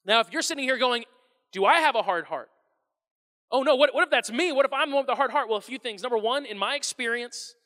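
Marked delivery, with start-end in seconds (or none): 1.5-5.5 louder, a touch of mock panic; mock worried. Pastor voicing an anxious listener, mock worried. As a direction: fret aloud, half-joking. Listener worrying about hard heart.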